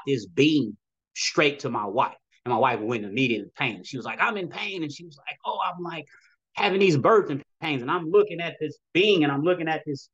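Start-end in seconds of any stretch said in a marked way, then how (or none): 4.2-4.8 higher-pitched mock complaint; imitating pained wife. Voicing wife in sudden birthing pain. 5.4-7.8 raised-pitch retold labor complaint; imitating pained wife. Voicing wife in birthing pains.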